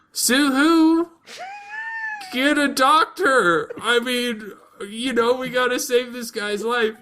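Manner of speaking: high frantic plea, half laughing; panicked princess. Retold or imitated. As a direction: shriek for help in a hammed-up panic. Daughter panics over dying father.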